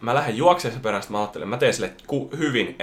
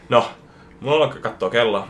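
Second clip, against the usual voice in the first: usual speaking voice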